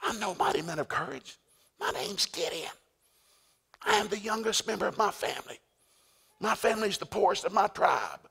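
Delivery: high-pitched wimp voice; whiny Gideon. Retold or imitated. Gideon makes excuses to the angel, wimpy high voice. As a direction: plead your weakness in a high little voice, building. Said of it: "And finally, Gideon says".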